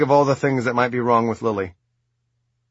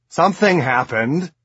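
insistent; 6 semitones higher, 5 dB louder than usual. A narrator's reading. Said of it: "I insist".

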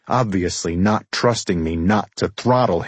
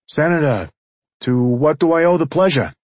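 higher-pitched mobster, sounding put-upon courteous; mobster's mock-courteous greeting; 7 semitones higher, 4 dB louder than usual. A narrator's reading. Mobster greeting his son-in-law at the door, mock courtesy. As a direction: greet an unwelcome guest with high-pitched formality.

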